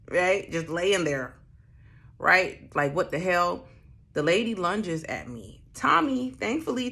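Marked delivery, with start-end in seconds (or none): none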